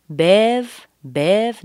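BEV stretched and punched loud; long and strong. Shown as a demonstration. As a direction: hold "bev" long, with force. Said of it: "nice and long and strong".

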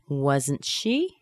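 "Wasn't she" rising steeply at the end; rising intonation. Shown as a demonstration. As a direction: lift "she" as a question. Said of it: "Going up in intonation".

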